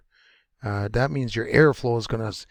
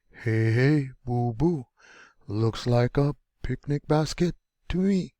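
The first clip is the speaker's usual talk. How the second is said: Yogi Bear; 2 semitones higher than usual. Acted. Teacher illustrating a rising accent for learners, cartoon bear impression. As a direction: stretch it out and lift the phrase endings like a cartoon bear. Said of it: "like Yogi Bear".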